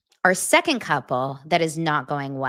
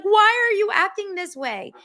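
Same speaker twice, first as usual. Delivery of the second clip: much higher, louder mock alarm; shrill accusing bride; 4 dB louder than usual. Bride accusing the drunk groom, high and shrill. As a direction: play her scared and accusing. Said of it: "she's like".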